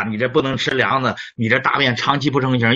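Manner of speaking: ordinary talk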